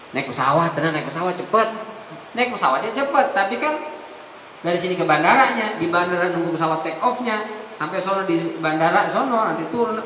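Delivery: ordinary delivery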